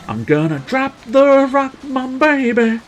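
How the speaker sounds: high, loud cocky brag; hyped boast. Pretend. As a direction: brag it like a hype man. Hyped boast about bomb.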